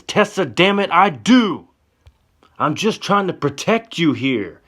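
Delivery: very high, loud cry of frustration; loud, pained insistence; desperate shout. Acted. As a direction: shout it while gripping her arms. Johnny grabs her, shouting.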